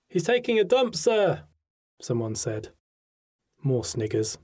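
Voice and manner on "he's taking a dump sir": cheeky pupil. A narrator's reading.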